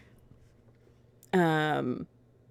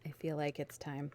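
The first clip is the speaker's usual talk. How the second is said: ordinary talk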